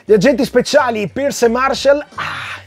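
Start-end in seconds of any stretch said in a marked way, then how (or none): none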